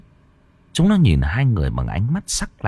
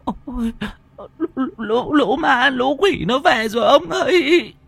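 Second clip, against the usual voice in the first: very high-pitched stuttering wail; terrified stammer. Acted. Wife clings to her husband at the ghosts, stammering in terror. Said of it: "miệng lắp bắp".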